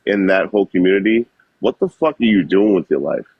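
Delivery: normal talking voice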